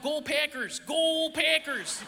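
drawn-out Wisconsin Go Packers; Wisconsin accent. Retold or imitated. Imitating Wisconsin fans chanting, Wisconsin accent. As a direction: stretch Packers slow in broad Wisconsin vowels. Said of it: "It was like".

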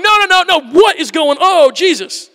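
high, loud, startled protest; mock panicked no, no, no; flustered disciple. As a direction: recoil in shock as your feet are washed. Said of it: "is literally going".

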